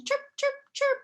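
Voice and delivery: very high chirps; squeaky mock chirping. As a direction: chirp like a little bird. Imitating chirp sounds.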